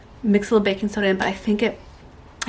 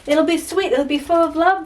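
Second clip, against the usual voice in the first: higher, louder sarcastic sing-song; sing-song the promises you were sold; mock quoting advice; 6 semitones higher, 7 dB louder than usual. Mother watching kids bowl each other over, sarcastic sing-song. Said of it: "they said".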